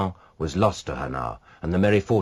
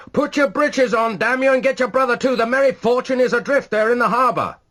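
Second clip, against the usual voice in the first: furious shout; 6 dB louder than usual. A narrator's reading. Rashleigh shouting back at the neighbour, furious shout. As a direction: roar the order in a blind fury. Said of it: "shouted back".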